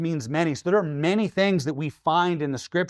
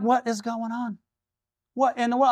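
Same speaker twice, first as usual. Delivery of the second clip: baffled; 7 semitones higher than usual. Retold or imitated. Baffled retelling.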